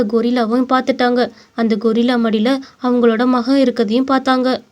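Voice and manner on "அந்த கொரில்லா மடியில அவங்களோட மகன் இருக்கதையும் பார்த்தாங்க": ordinary delivery, nothing marked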